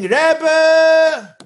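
one long high shouted cry; desperate scream. Acted. Chassid burning in Gehenna cries for his Rebbe, long high scream. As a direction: wail the name from the fire. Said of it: "He starts screaming".

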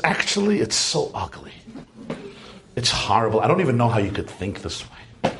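low, sour put-down; lightly voiced grumpy father running it down; grumpy dismissal. Father dismissing daughter's view, sourly low.

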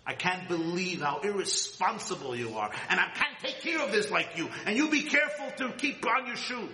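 big sister scolding her little brother, a touch of anger. Retold or imitated. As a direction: play a teen sister nagging her brother. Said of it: "I screamed at my little boy".

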